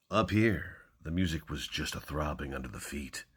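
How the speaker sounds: dramatic hardboiled crime reading; hammy stage actor. Acted. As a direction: punch each opening, then hush it. Reads crime novel openings dramatically.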